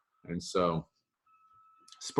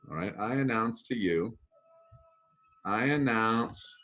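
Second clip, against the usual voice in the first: ordinary talk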